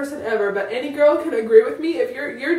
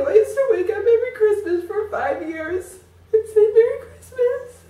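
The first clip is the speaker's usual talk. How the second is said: much higher, voice breaking into a sob; crying; 7 semitones higher, 3 dB louder than usual. Real, not acted. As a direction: squeeze it out through tears. Recalls Christmas mornings, crying.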